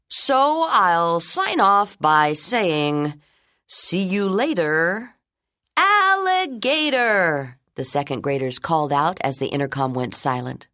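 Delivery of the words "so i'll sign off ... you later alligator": playful sign-off. Acted. Principal signing off playfully.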